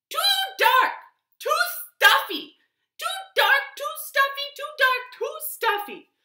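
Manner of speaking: very high, loud squeaky complaint; little creature voice. Acted. The hudgeon complains about its bed, very high and loud. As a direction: squeak it like a tiny fussy creature. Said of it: "he heard".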